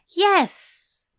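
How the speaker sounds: high, loud eager yes. A narrator's reading. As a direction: blurt it out without hesitation. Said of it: "not a hint of hesitation".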